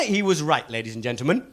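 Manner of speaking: usual speaking voice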